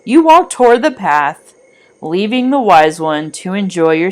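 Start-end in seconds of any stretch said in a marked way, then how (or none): none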